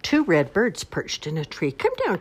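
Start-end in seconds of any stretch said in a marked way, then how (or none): 1.7-2.2 much higher bunny voice calling up. Acted. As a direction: call up into the tree as a little bunny. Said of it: "cried Bunny".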